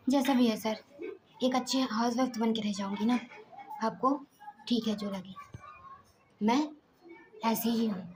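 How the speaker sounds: usual speaking voice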